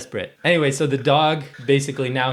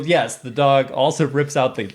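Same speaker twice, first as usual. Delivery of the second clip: ordinary talk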